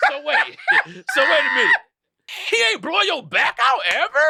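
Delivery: high squealing laugh into question; hammed-up disbelieving laugh; laughing disbelief. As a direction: ask it cracking up. Friend grilling wife, laughing.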